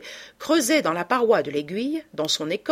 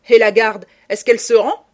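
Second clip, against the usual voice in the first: loud, raised retort; defiant shout; 3 semitones higher, 11 dB louder than usual. Acted. Lupin answers Ganimard's order to surrender, defiant shout. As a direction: throw the challenge back loudly.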